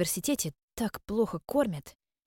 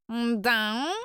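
meowing; 4 semitones higher than usual. Acted. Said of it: "мяукнув громкое".

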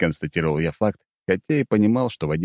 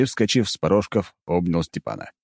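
usual speaking voice

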